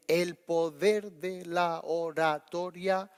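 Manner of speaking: put-on slow, halting child-like reading; reading without intonation. Father imitating his six-year-old daughter reading, halting and toneless. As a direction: sound out each word slowly, with no intonation. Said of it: "es falta de entonación".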